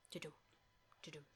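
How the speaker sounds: soft mouthed heartbeat thump; heartbeat sound. Retold or imitated. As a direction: thump it out like a pulse. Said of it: "she can hear a heartbeat".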